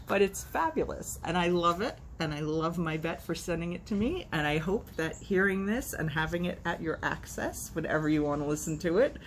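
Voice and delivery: ordinary delivery, nothing marked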